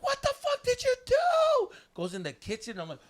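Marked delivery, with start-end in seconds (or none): 0.0-1.7 very high, loud, drawn-out shriek; mock furious mom. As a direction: imitate a mom screaming an accusation. Mom confronts him.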